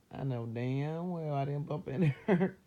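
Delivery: loud, laughing insistence; emphatic protest cracking into laughter; laughing denial. Real, not acted. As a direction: break into a laugh while insisting. Joking denial, laughing as she talks.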